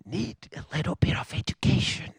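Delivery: hushed, confiding self-advice; whispered aside. Retold or imitated. Telling himself Americans need educating, whispered. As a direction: drop to a whisper on the last words.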